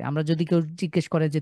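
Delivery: ordinary talk, nothing marked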